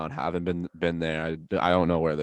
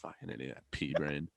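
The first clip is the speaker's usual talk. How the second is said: muttered insult; 3 semitones lower, 12 dB quieter than usual. Acted. Insulting the barista under breath.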